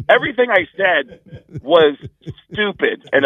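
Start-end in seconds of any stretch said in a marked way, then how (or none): none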